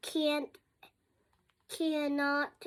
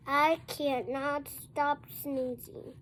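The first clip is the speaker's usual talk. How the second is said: plain reading voice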